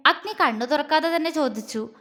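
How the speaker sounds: ordinary delivery, nothing marked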